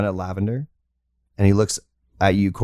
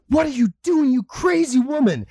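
much higher, scolding doctor voice; doctor yelling at the shooter; shocked doctor. Acted. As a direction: scold her in disbelief.

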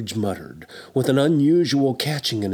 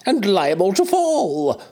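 put-on high, protesting old miser; pleading Scrooge; 9 semitones higher, 3 dB louder than usual. A narrator's reading. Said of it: "Scrooge remonstrated".

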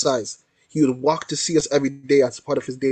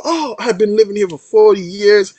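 retold in a high, loud voice; excited exclamation; 7 semitones higher, 6 dB louder than usual. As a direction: imitate an older man exclaiming, pitched up. Retold elderly man exclaiming to painter.

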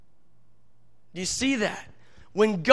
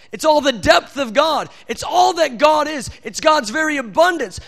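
louder and rising, preaching hard; building sermon; 4 dB louder than usual. Real, not acted. Preacher stirring the congregation, rising fervour. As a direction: drive the point home to the church.

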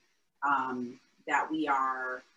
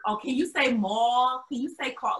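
lightly hammed-up teasing classmate; mocking classmates; 8 semitones higher than usual. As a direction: needle him to say mall and call. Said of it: "they want me to talk in my accent".

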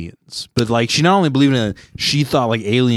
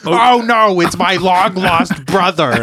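higher, drawn-out mock dismay; melodramatic character; 5 semitones higher, slower than usual. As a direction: ham up a tearful reunion. Hammed-up acted emotional scene.

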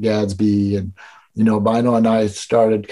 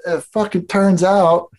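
ordinary talk

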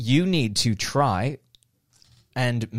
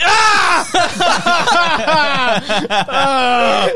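sharp yelps of pain from a shock; pained scream; 13 semitones higher, 7 dB louder than usual. Real, not acted. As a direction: cry out in real pain.

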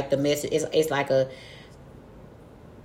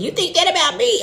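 high, wounded disbelief; hurt disbelief; 11 semitones higher, 3 dB louder than usual. Retold or imitated. Hurt at her dude's criticism, high and disbelieving. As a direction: jump up high, offended. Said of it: "but it's like".

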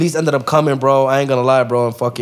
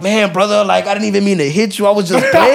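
mock pleading excuse; 7 semitones higher, 4 dB louder than usual. Attacker's excuse voiced.

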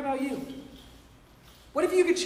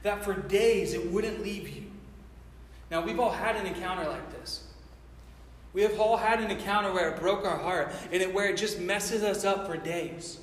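ordinary talk, nothing marked

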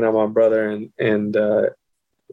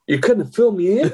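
high, laughing mock complaint; amused retold indignation; indignant disbelief; 9 semitones higher than usual. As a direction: replay an incredulous reproach, pitched up. Retelling complaint to his brother.

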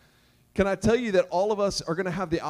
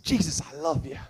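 low, hushed voice of a devoted follower; lightly acted pledge of loyalty, pitched down; low, urgent show of devotion; eager Peter; 6 semitones lower than usual. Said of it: "guys like Peter".

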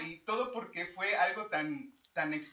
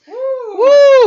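excited whoop; 21 dB louder than usual. Real, not acted. Cheering return to Blip Originals.

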